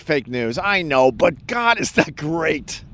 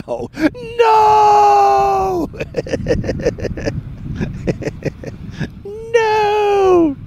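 yelling; much slower than usual. Retold or imitated. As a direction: scream no with a laugh. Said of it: "Everyone's yelling".

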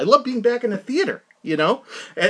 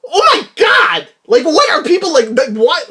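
shocked outburst; 8 semitones higher, 5 dB louder than usual. Retold or imitated. Vlogger reacting to awful previews, high shocked outburst. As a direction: recoil in disbelief. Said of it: "I'm like".

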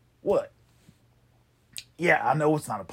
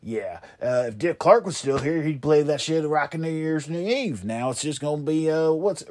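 ordinary delivery

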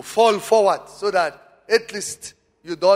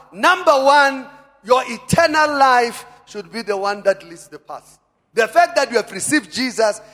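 loud, high-pitched sermon delivery; rousing pulpit exhortation; forceful preaching; 5 semitones higher, 7 dB louder than usual. Real, not acted. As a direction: preach it with full conviction.

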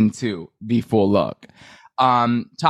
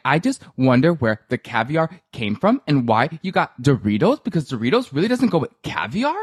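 imitating Ramona; 4 semitones higher than usual. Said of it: "Ramona would have been like".

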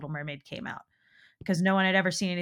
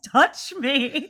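laughing mock quote; 8 semitones higher, 6 dB louder than usual. Repeats Gurgi line laughing.

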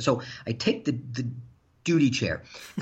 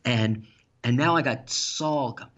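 normal talking voice

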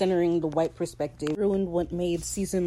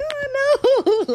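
very high, loud squeaky "Oh, no"; mock scared squeal; 7 dB louder than usual. Ed mimicking Fang running away, high squeal. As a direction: squeal it like a fleeing victim. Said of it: "You would run away like".

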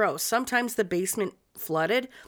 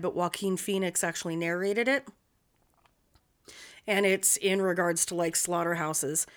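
ordinary talk, nothing marked

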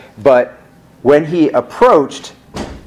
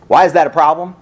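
ordinary delivery

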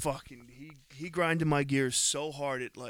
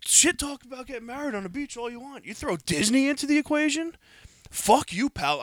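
much higher and louder, a furious outburst; angry rant; 11 semitones higher, 9 dB louder than usual. Real, not acted. Telling friends about the priest's jab, shouting in outrage. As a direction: let the outrage explode at the priest.

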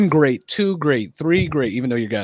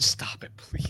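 whisper; 10 dB quieter, much slower than usual. Real, not acted. Whispered plea amid teasing.

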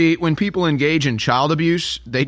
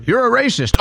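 mock accusation; 9 semitones higher than usual. Mocking accuser's triumphant line.

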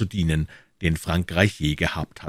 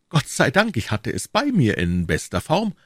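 put-on earnest young D'Artagnan; 7 semitones higher than usual. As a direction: protest as the eager young hero. D'Artagnan's voice answering Tréville. (eifriger junger Held, hoch einsetzend)

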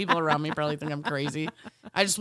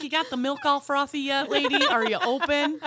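mock sassy; 8 semitones higher, 4 dB louder than usual. Retold teasing the lady.

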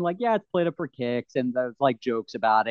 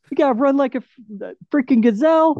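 higher and louder, hyped up; mock pep talk; excited mock coaching; 9 semitones higher, 5 dB louder than usual. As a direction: pump him up like a trainer. Excited retelling of coach line.

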